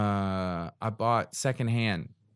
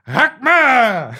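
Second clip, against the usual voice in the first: high, loud mock battle cry; hammed-up fighter battle cry; catchphrase shout; 12 semitones higher, 9 dB louder than usual. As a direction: yell it like a kung fu fighter. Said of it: "The catchphrase fighting".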